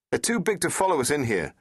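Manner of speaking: shouting. Acted. The Doctor to Rose during the chase, shouting. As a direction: call it out while running. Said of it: "shouted the doctor".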